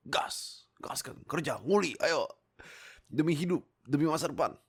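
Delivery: rally them to get to work; rallying cry. Pretend. Menyemangati penonton kerja. (seruan penyemangat lantang)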